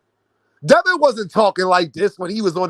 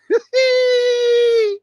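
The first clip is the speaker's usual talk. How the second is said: shrieking laugh. Real, not acted. Vlogger mocking a boxer's dad, shrieking laugh. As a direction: cackle at the absurd claim. Mocking Schofield's Kobe Bryant claim.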